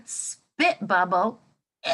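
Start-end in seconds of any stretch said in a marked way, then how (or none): 1.8-1.9 disgusted ew. Real, not acted. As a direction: squeal out a grossed-out ew. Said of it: "that's kinda gross".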